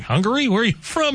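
high-pitched baffled guessing, rising at the end; retold puzzled question. Host retells asking a man about his accent, high puzzled questioning. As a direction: press the question, voice jumping up.

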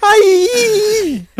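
very high, loud squealed coo; baby-talk coo. Pretend. Host parodying vice president over a pram, baby-talk squeal. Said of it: "cuando le hablas a un bebé".

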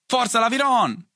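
high-pitched rooting cry; yelled cheer. A narrator's reading. Spectator cheering the duel, high yell. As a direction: cheer your fighter on. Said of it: "urlò qualcuno".

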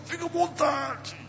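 high, weak, strained words; voice barely coming out. Retold or imitated. Pastor with broken ribs answering the man, faint strained voice. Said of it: "A voz já não saía".

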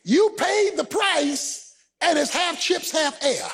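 forceful, high-pitched sermon delivery; impassioned preacher driving the point home; preaching. Real, not acted. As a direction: preach it hard to the congregation, stretching key phrases.

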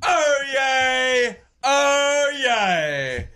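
slow, drawn-out mock-British crier's shout; town crier proclamation. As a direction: proclaim yourself grandly, stretching every phrase. Trying out the town crier call.

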